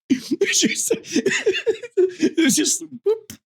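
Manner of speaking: high, breaking into laughter; laughing hard. Real, not acted. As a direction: squeak out the words through giggles. Laughing while telling funny story.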